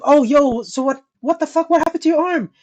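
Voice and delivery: asking the friend where his cast went; incredulous question. Retold or imitated. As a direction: call him out in disbelief. Confronting liar friend about arm.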